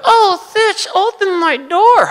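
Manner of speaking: very high, slow squeaky building voice; talking building. Pretend. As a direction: give the building a tiny pleading voice. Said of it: "Does the building talk?".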